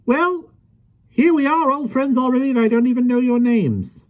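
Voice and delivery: put-on high, lively voice of a small old man; gentle, chatty little fellow; kindly little man. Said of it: "he chuckled".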